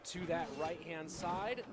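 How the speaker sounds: live play-by-play. Acted. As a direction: hype the big hit, then ask the question. Calls the match live.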